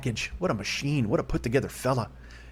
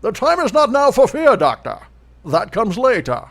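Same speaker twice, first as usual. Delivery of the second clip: slow, loud masked villain; Bane voice; 11 semitones higher, 10 dB louder, slower than usual. Pretend. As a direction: boom it out like Bane. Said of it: "that wasn't a bad Bane".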